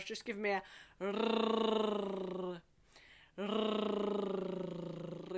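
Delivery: rrrr as a long trilled R; rolled R. Shown as a demonstration. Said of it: "let's roll our R's".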